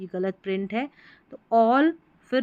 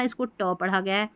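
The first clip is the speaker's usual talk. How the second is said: usual speaking voice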